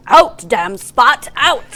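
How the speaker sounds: loud, slow theatrical cry; Lady Macbeth. Acted. Co-host walking around as a darker Shakespearean, hammed-up tragic cry. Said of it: "like Lady Macbeth and just walk around going".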